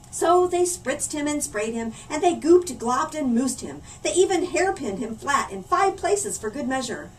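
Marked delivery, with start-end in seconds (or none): none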